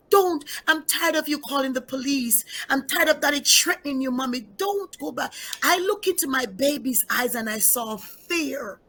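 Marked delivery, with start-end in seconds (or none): 0.1-5.3 put-on higher, pleading child voice with a touch of tears; crying little daughter. Mother voicing her crying daughter's plea, high tearful child voice. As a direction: beg like a frightened nine-year-old. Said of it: "And she cried".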